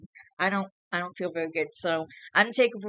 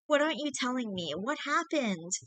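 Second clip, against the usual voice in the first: put-on pleading girlfriend; 7 semitones higher than usual. A narrator's reading. Girlfriend pressing him.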